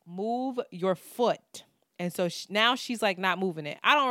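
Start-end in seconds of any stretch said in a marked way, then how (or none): 0.1-1.7 slow, word-by-word order; retold deliberate command; firm demand. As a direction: spell it out slowly as a warning. Retold demand to fellow passenger.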